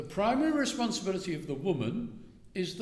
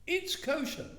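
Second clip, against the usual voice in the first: It's kosher jumping much higher, ringing; emphatic echo; 12 semitones higher than usual. Real, not acted. Rabbi echoing the wife's ruling, pitched up high. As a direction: lift It's kosher high to drive it home. Speaker echoes wife's verdict.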